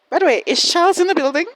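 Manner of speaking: high, loud and fast; playful shout. Real, not acted. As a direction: call out the joke. Jokey aside shout.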